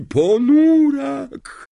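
high, loud, very slow drawn-out wail on ponurak; wailing cry. A narrator's reading. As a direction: stretch ponurak into a doom-laden wail. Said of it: "zawołała profesor Trelawney".

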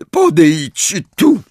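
high, loud, drawn-out summons; imperious high-pitched call; commanding teacher. A narrator's reading. Umbridge summons Harry in the story.

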